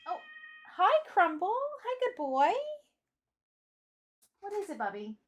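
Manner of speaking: high, sing-song pet voice; talking to the dog. Real, not acted. Greeting the dog.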